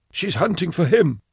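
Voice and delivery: Blake's voice. A narrator's reading. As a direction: lift the voice up for the nervous henchman. Blake's character voice.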